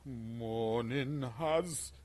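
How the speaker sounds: very slow sung hymn that collapses into a laugh; hymn broken by laughter. Pretend. Gamer trying the sunrise hymn again but cracking up. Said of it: "give it to them just as the sun comes".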